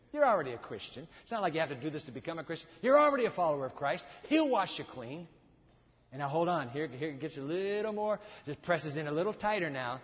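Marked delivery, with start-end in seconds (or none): none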